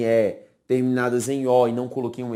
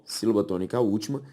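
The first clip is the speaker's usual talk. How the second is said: usual speaking voice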